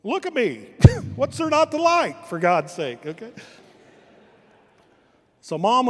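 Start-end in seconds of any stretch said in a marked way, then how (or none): 0.0-3.1 put-on cocky old dad. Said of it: "looked at him and goes".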